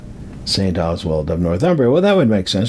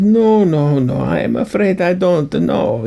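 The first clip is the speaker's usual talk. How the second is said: put-on terrible Hollywood accent; 8 semitones higher than usual. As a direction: protest in a badly faked movie-priest accent. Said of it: "that's a terrible accent".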